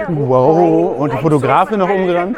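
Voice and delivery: surprised exclamation. Real, not acted. Startled exclamation near the camera team.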